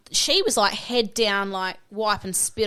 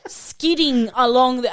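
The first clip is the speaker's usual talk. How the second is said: usual speaking voice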